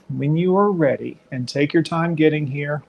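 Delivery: ordinary delivery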